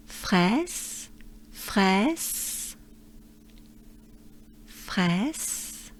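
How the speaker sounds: "fraise" com entoação a subir, ajustado; adjusted fraise. Shown as a demonstration. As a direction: diga "fraise" a subir no fim. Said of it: "I need to switch it a bit to".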